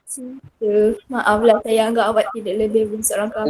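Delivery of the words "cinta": breathy whisper on Cinta?; whispered question. Shown as a demonstration. Girl turning down a suitor, whispering Cinta?. Student reads role-play script.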